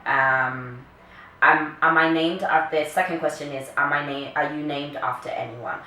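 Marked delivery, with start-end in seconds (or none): none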